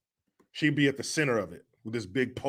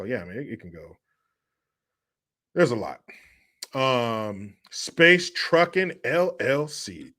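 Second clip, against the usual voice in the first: normal talking voice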